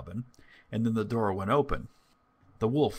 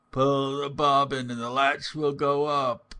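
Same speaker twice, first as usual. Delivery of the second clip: lightly disguised wolf, a little raised; wolf's softer voice; 4 semitones higher, 4 dB louder than usual. A narrator's reading. Wolf posing as grandmother, calling in a softer voice. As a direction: call out with the voice slightly softened and lifted. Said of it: "The wolf cried out in a softer voice".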